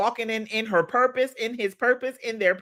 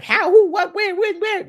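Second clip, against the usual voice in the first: high, loud frantic questions; anxious racing mind; 12 semitones higher, 6 dB louder than usual. Retold or imitated. Coach imitating her anxious mind, high and loud. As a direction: fire off the panicky questions high. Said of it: "my mind start to be like".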